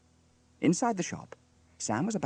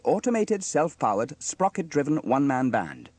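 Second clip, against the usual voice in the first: loud, raised, proud announcement; 5 semitones higher, 6 dB louder than usual. A narrator's reading. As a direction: show off your new invention. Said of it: "explained Sam proudly".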